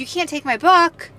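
retold indignant objection, pitched high; indignant protest. Traveler objecting to airport staff taking her book, indignant.

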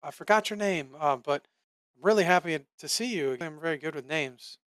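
high, fast and overly friendly; charmed dwarf. Acted. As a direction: gush like a dazed new friend. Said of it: "he says".

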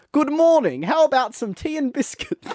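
a high, hammed-up attempt at an English accent. Shown as a demonstration. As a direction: put on your best posh English accent, badly. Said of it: "Give me a sentence to say".

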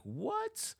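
squeaked high in disbelief; a stunned, soaring what?; shocked what. Retold or imitated. Coworker hearing she must hand over her keys, stunned.

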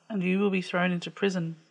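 normal talking voice

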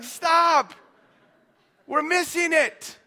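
high, loud "Stop", then slowing down; exasperated shout. Retold or imitated. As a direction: cut them off loudly, then press the point slowly. Frustrated pastor halts the teens.

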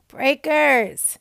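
calling out to the audience; big enthusiastic call; loud call-out. Real, not acted. Calling out to the community.

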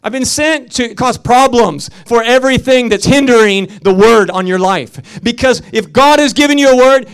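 emphatic, stretched key words; full-force preaching. Real, not acted. Preacher telling congregation the word will arise, full-force preaching. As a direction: hammer the promise home to the crowd.

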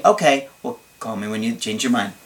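quick, quiet robotic reply; brisk flat robot brush-off. Shown as a demonstration. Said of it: "kinda like a robot".

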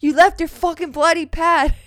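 high, loud accusing rant; hammed-up disgusted yelling; imitating outraged brother. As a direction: accuse them in mock horror.

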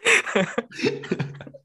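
high-pitched wheezy laugh; helpless high giggle. Real, not acted. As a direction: burst into a high laugh, then let it tail off. Laughing at own failed explanation.

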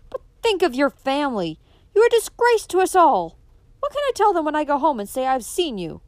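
loud, high furious father's shout; outraged father yells at his daughter; angry outburst. Acted.

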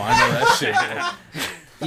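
high, slow, laughing. Real, not acted. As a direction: laugh through it. Laughing loudly.

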